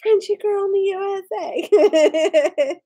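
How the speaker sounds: high slow laughing chant; laughing singsong greeting to a friend. Real, not acted. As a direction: laugh through the little chant.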